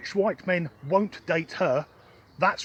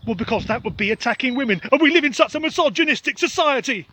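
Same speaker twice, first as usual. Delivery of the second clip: much higher and louder, mock outrage; sarcastic outburst; 7 semitones higher, 6 dB louder, faster than usual. Mocking the headline writers with loud sarcastic outrage. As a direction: throw the line up in mock indignation.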